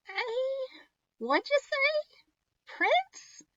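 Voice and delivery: very high, slow croaky crone; croaking old witch. Acted. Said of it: "croaked the witch".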